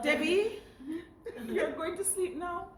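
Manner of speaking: high-pitched self-address, as if at bedtime; talking to herself. Retold or imitated. Telling herself to go to sleep, high bedtime self-talk. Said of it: "I tell myself".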